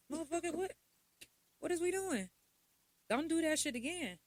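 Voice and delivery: retold rebuke. Daughter scolding her father, mock-outraged. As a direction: tell him off like he crossed a line.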